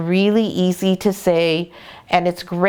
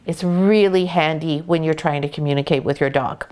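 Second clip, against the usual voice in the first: ordinary delivery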